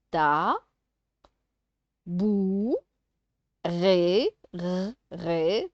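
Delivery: "ta, bou, ré" stretched with long pauses; syllable by syllable. Shown as a demonstration. Teacher sounding out syllables for a child, "ta, bou, ré" apart. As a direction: split "ta, bou, ré", then isolate the R of "ré". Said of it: "de faire les syllabes".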